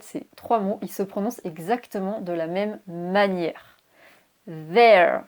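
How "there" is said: There, louder, with a buzzing TH; voiced TH. Shown as a demonstration. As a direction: vibrate the TH at the start of There. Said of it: "on a un T H dur". (There avec un TH qui vibre)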